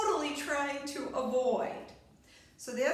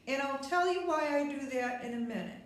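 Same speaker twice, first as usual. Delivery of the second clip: ordinary talk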